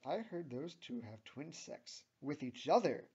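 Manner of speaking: mildly gossipy sarcasm; slightly sarcastic. A narrator's reading. Tammy gossips about the twins, slightly sarcastic. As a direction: lift the end with light mockery. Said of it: "says Tammy slightly sarcastically".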